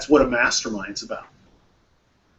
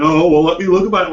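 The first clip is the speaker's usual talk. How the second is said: mock evasive stalling, pitched up and louder; hedging and weird; 7 semitones higher, 10 dB louder, faster than usual. Boss imitating his old self stalling the director, evasive mock voice. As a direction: imitate someone dodging the task awkwardly. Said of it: "like, being all weird about it".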